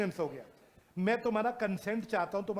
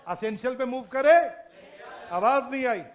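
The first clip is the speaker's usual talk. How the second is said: loud, higher call to the class; 3 semitones higher, 9 dB louder than usual. Real, not acted. Teacher telling silent students to speak up, shouting. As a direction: make them answer, raise your voice.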